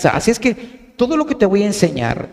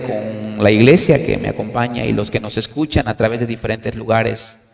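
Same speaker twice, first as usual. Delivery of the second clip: prayer; 7 semitones lower than usual. Real, not acted. Pastor prays before the study.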